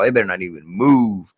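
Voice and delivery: ordinary talk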